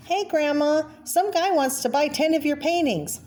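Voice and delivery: lightly voiced young woman, pitched high; daughter-in-law calling. Daughter-in-law calling Grandma about the buyer, high excited voice.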